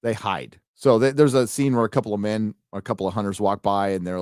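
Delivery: ordinary delivery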